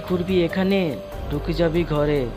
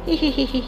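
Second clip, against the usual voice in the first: sneaky giggle; 10 semitones higher, 3 dB louder than usual. Acted. Thief giggles at blessing.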